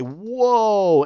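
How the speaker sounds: loud, high, drawn-out whoa; awed whoa. Retold or imitated. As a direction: act out an amazed viewer's reaction. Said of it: "You're like".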